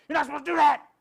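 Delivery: sudden high, loud yell; wired-up explosive scolding; nervous outburst. Retold or imitated. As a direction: blurt the correction out in a shout. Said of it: "because they're nervous".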